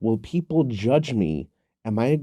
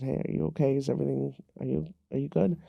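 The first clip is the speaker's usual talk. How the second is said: gentle concern; 4 semitones higher, 7 dB quieter, faster than usual. Retold or imitated. Checking gently on upset mother.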